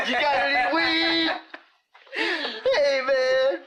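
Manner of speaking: high, drawn-out goofy stoner voice; silly high voice through laughter; Batman. Acted. Host turns the impression into a stoner joke, high-voiced. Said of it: "my Batman voice would be like".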